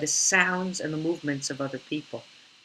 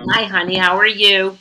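excited greeting; 6 semitones higher, 12 dB louder than usual. Real, not acted. Greeting the host warmly.

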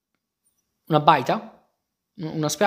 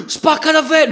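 gasping, much higher and louder; amazed gasp; 10 semitones higher, 6 dB louder than usual. Real, not acted. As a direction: let the wonder burst out. Amazed by the drill.

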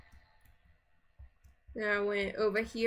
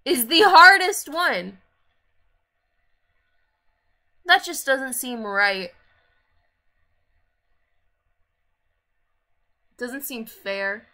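mock outrage; 10 dB louder than usual. Real, not acted. Ranting about hard challenge.

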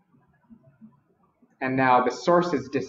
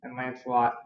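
ordinary delivery, nothing marked

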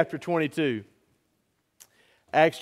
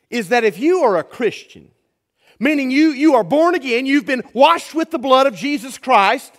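rising, louder preacher's push; preaching at full force; 8 semitones higher, 7 dB louder than usual. Real, not acted. Pastor to his church, preaching at full force. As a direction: build it up like a pulpit sermon.

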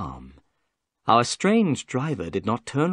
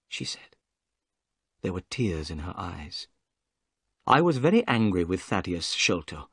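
ordinary delivery

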